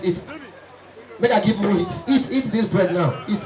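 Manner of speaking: ordinary talk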